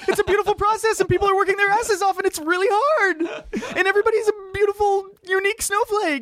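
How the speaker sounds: very high, strained character wail; mock screaming. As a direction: squeal it like an overwhelmed, tearful convert. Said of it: "What are you screaming about".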